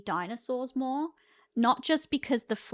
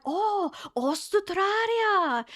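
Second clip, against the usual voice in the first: high, drawn-out delighted exclamation; excited recognition; 9 semitones higher, much slower than usual. Retold or imitated. Japanese locals learning the husband is Australian, excited exclamation. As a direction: stretch it out in imitated amazement. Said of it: "they got really excited".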